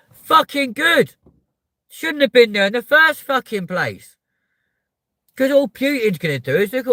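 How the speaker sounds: real angry yell; angry shout. Real, not acted. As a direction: bellow it, fed up. Ranting against flags.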